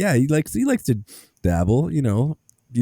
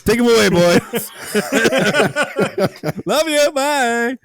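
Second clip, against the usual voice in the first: hammed-up farewell; 11 semitones higher than usual. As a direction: overplay a teary farewell. Mock farewell to son.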